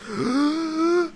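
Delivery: high sudden gasp; a quick surprised intake of breath; sharp gasp. Real, not acted. Sudden gasp mid sign-off.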